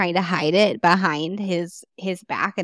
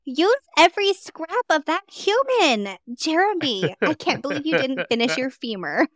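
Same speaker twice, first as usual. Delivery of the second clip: mock scolding parent; 6 semitones higher than usual. Mock parent scolding cannibal child.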